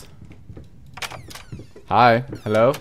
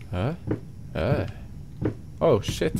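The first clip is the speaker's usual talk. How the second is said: normal talking voice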